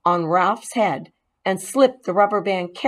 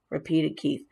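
plain reading voice

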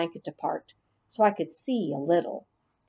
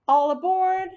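higher, louder bus driver's call; 12 semitones higher, 4 dB louder than usual. Acted. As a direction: call everyone aboard like a cheery bus driver. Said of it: "I heard Miss Victoria say".

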